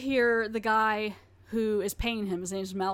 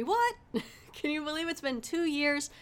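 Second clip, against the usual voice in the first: mock surprise; 6 semitones higher than usual. Mock surprise at own anniversary.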